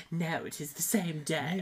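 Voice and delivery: mimicking a British accent; teasing imitation of British speech; mock British. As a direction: parrot it back in exaggerated British. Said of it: "Stop going British with".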